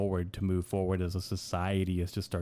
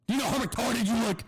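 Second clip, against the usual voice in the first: much higher, squeaky outraged taunt; mock outraged cop taunt; 14 semitones higher, faster than usual. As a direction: scoff at the cop, voice jumping way up. Ranting at imagined police officer.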